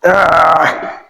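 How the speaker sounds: loud, high release after the set; effort grunt. Real, not acted. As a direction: let out a loud gasp. End of set.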